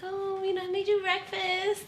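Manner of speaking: high, slow sugary voice; mock sweet. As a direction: play the sweetheart after a blowup. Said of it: "I got mad sweet again".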